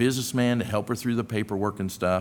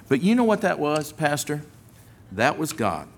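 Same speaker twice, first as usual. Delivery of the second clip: ordinary delivery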